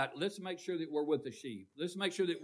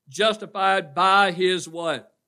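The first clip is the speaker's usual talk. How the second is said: loud prompting; 3 semitones higher, 11 dB louder, slower than usual. Real, not acted. Preacher prompting congregation, building.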